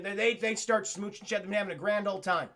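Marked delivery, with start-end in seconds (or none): none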